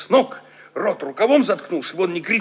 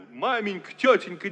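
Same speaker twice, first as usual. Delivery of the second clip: ordinary delivery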